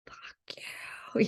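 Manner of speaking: mock scream kept to a whisper; whispered curse. Venting anger at parents, whispered curse.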